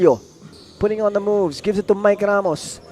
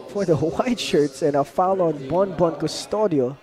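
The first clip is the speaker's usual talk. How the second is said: usual speaking voice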